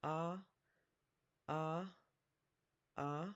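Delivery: "Ah" as said for a doctor checking the throat; vowel sound demo. Shown as a demonstration. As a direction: open "ah" like the start of Oscar, each one separate. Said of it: "like what you would say".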